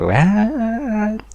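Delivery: high, soft, drawn-out mock disbelief; incredulous. Replying to the accusation at jail, high incredulous disbelief. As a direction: squeal it softly, as if baffled.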